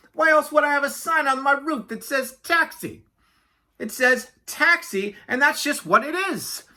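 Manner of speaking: high, self-important patter; indignant chatterbox Yak. Acted. Yak boasting to Walrus of his taxi service, chatty. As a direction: rattle on proudly as Yak.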